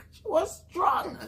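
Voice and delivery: high, laughing squeal of disbelief. Real, not acted. Laughing at memory of sister.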